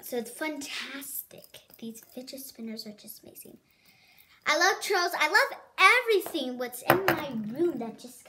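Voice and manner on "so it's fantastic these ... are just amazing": whisper. Acted. Whispered product praise.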